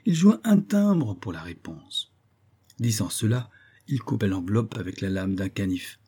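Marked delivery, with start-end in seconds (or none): 0.1-2.1 "un timbre" drawn out high and slow; slow, raised stress on "un timbre"; emphatic reveal. A narrator's reading. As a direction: stretch "un timbre" as the crucial point. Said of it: "entendez bien ceci, car là est le point".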